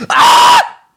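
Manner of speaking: sudden ear-splitting high scream; full-force, very high "Ah!" shriek; yelling. Shown as a demonstration. Reader approximating Mewtwo yelling, piercing scream. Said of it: "an approximation of Mewtwo".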